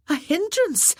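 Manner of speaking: high, sharp, hurt disbelief; indignant exclamation. Acted. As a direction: throw the word back, stung and offended. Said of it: "she exclaimed".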